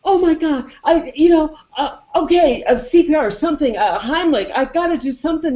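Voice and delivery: high, frantic mock scrambling; flustered panic. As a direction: fumble aloud for a rescue, acting panicked. Voicing Rudd scrambling to save him.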